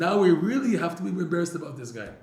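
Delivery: loud, high, rushed retelling; flustered embarrassment. Retold or imitated. Acted reaction of embarrassed listeners.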